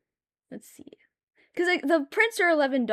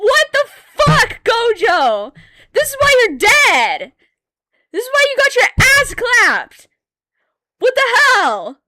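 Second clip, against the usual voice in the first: much higher, loud furious yelling; outraged yell; 10 semitones higher, 9 dB louder than usual. Retold or imitated. Yelling at Gojo for joining the freaks, furious high yell. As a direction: scream at the traitor. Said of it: "And I'm like".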